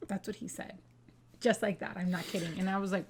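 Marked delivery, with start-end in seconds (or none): 0.1-0.8 soft unvoiced aside, still stunned; whispered aside. Real, not acted. Wife telling listeners what her husband said, whispering in disbelief. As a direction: breathe it out in disbelief.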